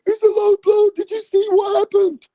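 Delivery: mock whiny fan. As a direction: parody the fans protesting, high pitched. Mocking fans' low blow cry.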